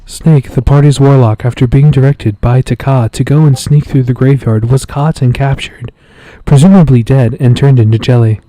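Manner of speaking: loud, measured narrator right on the mic; recap announcer. Pretend. Game master recapping the warlock's fate, loud announcer. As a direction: announce the character's fate with force.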